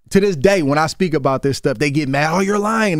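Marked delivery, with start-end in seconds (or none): 2.3-3.0 high mocking jab; mocking quote. Boxer mocking fans who call him a liar, high and jeering. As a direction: mimic the fans' jeering. Mocking fans' online reaction.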